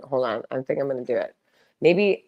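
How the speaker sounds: ordinary talk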